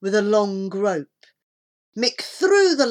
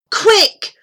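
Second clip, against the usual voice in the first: high, loud urgent cry; raised, pressing exclamation; 14 semitones higher, 10 dB louder than usual. A narrator's reading. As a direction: hurry them with one sharp word. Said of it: "said Debbie".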